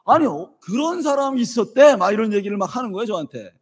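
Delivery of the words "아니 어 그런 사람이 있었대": indignant question, half-acted; imitating the son fuming on the phone; huffing indignation. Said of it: "화가 난 그런 상태가 좀 막 씩씩거리면서".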